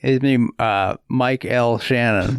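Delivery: ordinary talk, nothing marked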